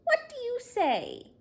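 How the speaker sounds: high, loud and thrilled; excited announcement. Acted. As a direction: whip up the crowd with joy. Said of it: "she announced".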